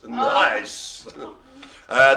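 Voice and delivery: ordinary talk, nothing marked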